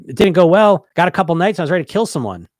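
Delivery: loud, high burst of frustration; exasperated client. Retold or imitated. Client telling the coach how badly it went, loud and exasperated. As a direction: vent it like the week was a disaster. Client reports failed week.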